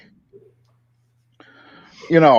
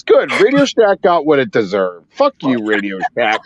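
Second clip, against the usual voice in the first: ordinary delivery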